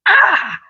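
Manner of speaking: sharp high yelp of pain. Retold or imitated. Yelp at the hot fork in the kitchen, short high pained cry. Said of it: "and I screamed".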